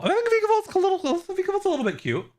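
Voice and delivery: very high squeaky cooing; high cutesy squeal. Real, not acted. Streamer admiring a Pokemon on screen, high cutesy squeal. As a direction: coo it way up high.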